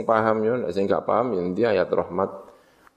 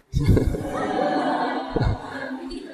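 high unvoiced burst of laughter; airy amused laugh; breathy laugh. Real, not acted. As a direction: laugh out at the joke. Laughs at the punchline.

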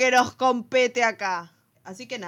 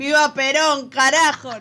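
loud political chant; rally shout; 4 semitones higher, 7 dB louder than usual. Pretend. Mock political rally cry.

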